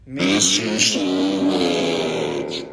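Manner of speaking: low, slow warped voice; voice effect. Shown as a demonstration. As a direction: sing it through the voice changer. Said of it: "miss din namin kayo".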